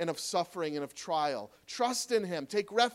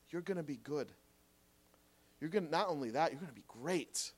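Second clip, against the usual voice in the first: softer and lower, gentle promise; tender reassurance; 3 semitones lower, 6 dB quieter than usual. Real, not acted. Preacher assuring hurting listeners, tender and soft. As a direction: comfort them quietly, one by one.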